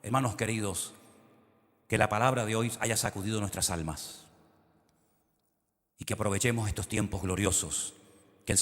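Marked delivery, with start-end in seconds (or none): none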